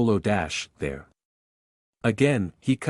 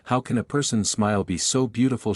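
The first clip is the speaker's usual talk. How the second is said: ordinary delivery, nothing marked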